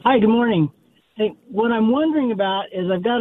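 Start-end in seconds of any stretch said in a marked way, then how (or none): none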